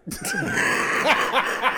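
sudden high shriek of laughter; cracking up hard at the joke. Real, not acted.

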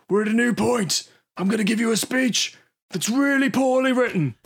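high-pitched, declaiming parody of a battle speech; hammed-up rallying oratory; mock rousing speech. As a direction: proclaim it like a badly written film hero. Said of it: "a rousing speech".

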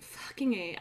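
usual speaking voice

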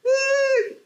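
very high, thin mock wail; little-girl crying imitation. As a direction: squeal out a long imitation of a small child crying. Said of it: "empezó a llorar así como niña chiquita". (llanto imitado de niña chiquita, agudísimo)

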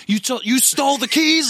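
high, loud incredulous accusation; loud, disbelieving question; mock outrage. Acted quarrel over stolen keys.

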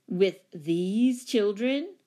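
loud, slow, incredulous question; scandalized. Acted. Commanding tenant shocked that children would stay, scandalized. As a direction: draw it out in disbelief, rising.

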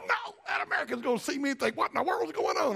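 a sudden flustered yelp of being caught; mock panic. Panicked thought when seen hugging.

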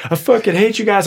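high-pitched mock hatred; retold angry outburst. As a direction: imitate an upset customer lashing out.

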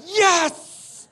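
very high, drawn-out yes; mock victory cry; triumphant cheer. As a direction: cheer it like the whole gang rallying. Friends cheer the rousing speech.

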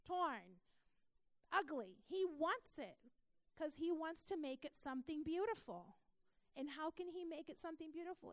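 preaching at full force. Acted. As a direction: swell into a passionate sermon climax. Preaching climax on God's love.

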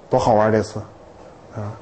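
ordinary talk

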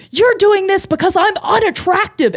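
high-pitched mock shriek of accusation; retold screaming. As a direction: imitate an outraged customer wailing at the bartender. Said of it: "she's screaming this at me now".

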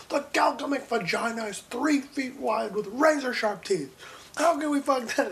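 mock alien bishop. Friend voicing an alien bishop in a joke, high mock voice. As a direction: squeak it out like a made-up alien priest.

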